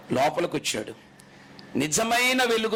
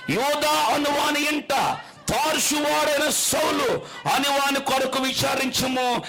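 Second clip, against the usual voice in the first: declaimed scripture; 8 semitones higher, slower than usual. Acted. Preacher reading God's command to Ananias, declaiming. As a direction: thunder the verse out slowly.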